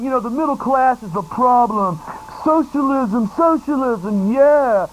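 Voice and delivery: hammed-up heckler; mocking voice of a socialist heckler; mock crowd member. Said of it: "they had people in the crowd going".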